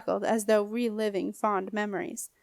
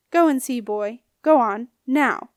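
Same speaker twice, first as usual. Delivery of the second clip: louder, sharp command; commanding order; 2 semitones higher, 7 dB louder than usual. A narrator's reading. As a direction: send him off with force. Ghost orders the boy.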